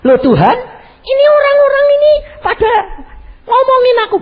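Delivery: tearful complaint. Retold or imitated. Complaining to God, crying.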